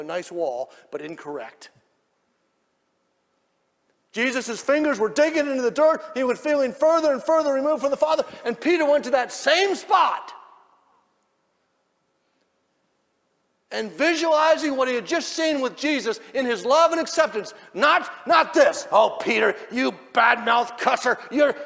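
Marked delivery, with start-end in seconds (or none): 4.1-18.9 high, loud impassioned sermon shout; slowing, hammered-out pulpit shout; full-force preaching. Real, not acted. 18.9-21.6 high, loud mock reproach; hammed-up shouted telling-off; loud scolding.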